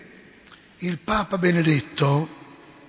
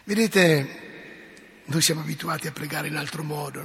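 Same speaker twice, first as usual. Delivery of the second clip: normal talking voice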